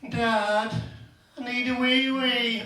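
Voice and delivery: put-on high little kid voice asking for the toilet; whiny kid. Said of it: "the kids say".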